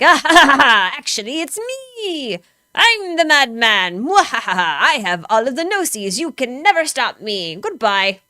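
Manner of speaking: hammed-up villain cackle and gloating, loud and high; Dottore. Streamer mocking Dottore's villain reveal, gloating madman voice. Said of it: "That's my perfect Dottore impression".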